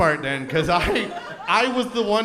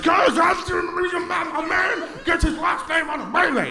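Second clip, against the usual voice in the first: high, strained mock scream; angry screaming guy; 9 semitones higher than usual. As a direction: rant like an outraged man, way over the top. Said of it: "Why are you so angry?".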